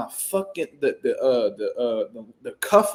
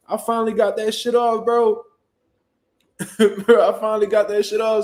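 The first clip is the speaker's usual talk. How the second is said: ordinary delivery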